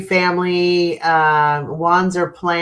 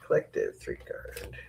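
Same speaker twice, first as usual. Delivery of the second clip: much softer murmured repeat under the breath; muttered to herself; 16 dB quieter than usual. Real, not acted. Reader murmurs the card name to herself, very soft. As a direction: quietly echo the card name to yourself.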